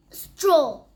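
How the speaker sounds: ordinary delivery, nothing marked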